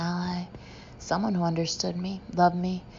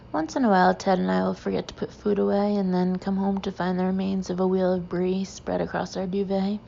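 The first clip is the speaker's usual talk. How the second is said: usual speaking voice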